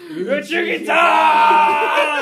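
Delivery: slow, loud, high shout of a name; booming shout. Retold or imitated. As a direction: bellow the name dramatically. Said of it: "and then he just goes".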